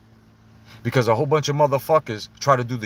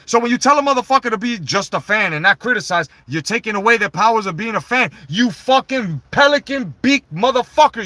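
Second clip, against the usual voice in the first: louder, higher angry rant; heated rant; 9 semitones higher, 6 dB louder than usual. Real, not acted. Vlogger ranting at Triple H, louder and angry. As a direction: lay into him with rising heat.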